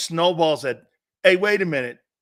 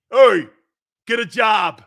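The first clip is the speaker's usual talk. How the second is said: louder, higher gruff shout out the window; grandpa's yell; 6 semitones higher, 12 dB louder than usual. Retold or imitated. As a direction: bark it like a cranky old man. Grandpa yells at Santa again.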